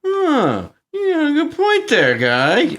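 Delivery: high, comic dim-henchman voice; mock being won over far too easily; gullible villain. Mimics easily convinced villain.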